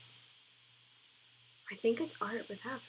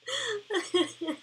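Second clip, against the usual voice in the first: high, teary laughter; laughing so hard it almost turns to crying; high squealing laugh; helpless laugh; 10 semitones higher than usual. Real, not acted. Finding an old post hilarious.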